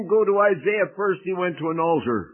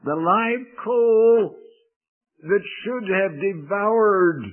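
higher, louder and slow, each word hammered; emphatic repeat; 4 semitones higher, 7 dB louder, slower than usual. Shown as a demonstration. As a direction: drive the words home like a preacher. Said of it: "Did you hear me?".

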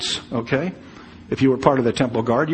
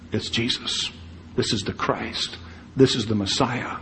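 reverent whisper; 3 semitones lower, 8 dB quieter than usual. Acted. Preacher's hushed awe naming Christ.

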